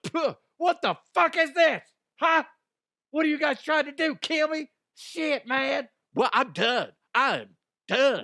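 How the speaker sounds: high, loud hammed-up outrage; mock outraged guest. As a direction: ham up a rude guest spitting out food. Acted spitting out food rudely.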